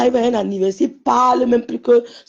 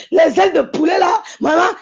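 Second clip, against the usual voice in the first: high, loud exclaiming over the food; rowdy guys; 7 semitones higher, 5 dB louder than usual. Retold or imitated. Guys raving to each other about chicken wings, rowdy shouting. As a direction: cry out like an over-excited mate. Continuing the guys' rowdy chat.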